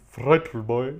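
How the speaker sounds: a light hint of the playwright saying frightful; Noel Coward voice. Organist imitating the playwright for viewers, lightly voiced. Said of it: "Spoke like that all the time".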